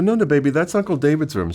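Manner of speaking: put-on high-pitched child-directed voice; retold coaxing of a small child; talking to toddler. Retold adult correcting a small child.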